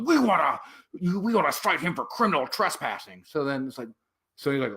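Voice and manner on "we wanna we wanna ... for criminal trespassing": higher-pitched mock guard voice; mocking imitation of insistent security; officious security guard. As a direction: demand it like an overeager guard. Imitates private security demanding citation.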